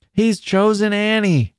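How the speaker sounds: high, drawn-out exclamation; girls' chorus. A narrator's reading. Girls reacting in chorus to his choice, high exclamation. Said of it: "said the girls in chorus".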